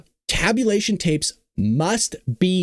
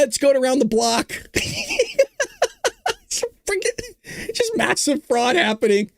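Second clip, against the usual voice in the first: ordinary delivery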